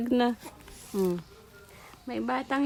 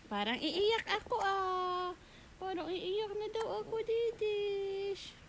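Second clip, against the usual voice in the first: put-on baby voice; 9 semitones higher, 8 dB quieter than usual. Voicing the baby's feelings.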